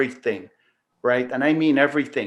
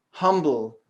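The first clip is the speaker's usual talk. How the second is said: usual speaking voice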